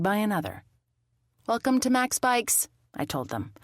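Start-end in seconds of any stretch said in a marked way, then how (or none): 1.5-2.7 lightly voiced, higher-pitched welcome; shopkeeper's greeting. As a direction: greet customers in a lifted service voice. Shop owner greets customers.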